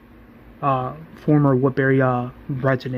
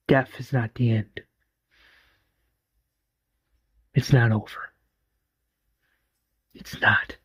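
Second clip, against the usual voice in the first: grieving voiceover. Acted. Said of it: "we hear this voiceover".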